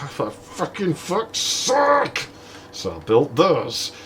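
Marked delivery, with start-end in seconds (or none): 0.0-2.3 high, slow, drawn-out exasperation; exasperated outburst. Retold or imitated. Soaked by the toilet, he groans in exasperation. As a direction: groan it out, fed up. Water soaks him again.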